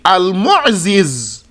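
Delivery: "Al-Mu'ziz" with two separate z sounds; original unmerged form. Shown as a demonstration. Teacher showing the original form, "Al-Mu'ziz" with both zays apart. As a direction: split "Al-Mu'ziz" so z starts and ends the last syllable. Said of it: "originally Mu'izz would be".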